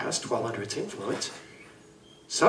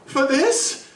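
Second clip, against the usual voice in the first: much higher, louder repeat of the question; outraged disbelief; 12 semitones higher, 3 dB louder than usual. Acted. Doctor to the lawyer, repeating it, higher and outraged. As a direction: throw it back at him, appalled. Doctor appalled at legal excuse.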